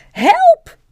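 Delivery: very high, loud panicked shriek; crying for help. A narrator's reading. Wife as she lifts into the air, crying for help. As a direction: shriek it in sudden fright. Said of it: "riep ze".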